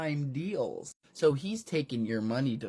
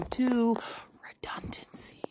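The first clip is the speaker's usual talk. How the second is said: villain ending his list in a whisper; creepy whisper; 10 semitones higher than usual. Acted. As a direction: fade to a breathy whisper.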